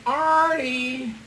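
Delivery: high, drawn-out seductive call; hammed-up come-hither call; mock sexy voice. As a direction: imitate a sultry woman calling her lover. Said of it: "It was a really sexy voice".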